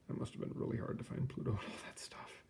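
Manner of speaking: soft unvoiced whisper; whispered aside. Real, not acted. Reader whispering an aside about finding Pluto. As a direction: whisper it like a secret remark.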